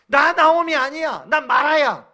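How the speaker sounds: loud, high, anguished protest; bitter outcry. Retold or imitated. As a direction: cry out that she is no longer Naomi. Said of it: "나오미가 ... 이렇게 반응을". (괴로운 외침)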